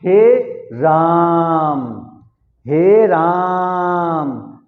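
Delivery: हे राम with the आ of राम held very long; very slow, drawn-out हे राम; extra-long vowel. Shown as a demonstration. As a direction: stretch राम out slowly on a sustained tone. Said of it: "ज्यादा समय लगा ना".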